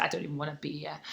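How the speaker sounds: soft, understated sulky grumble; retold sulk. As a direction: sulk quietly, as if to yourself. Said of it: "I was sulking".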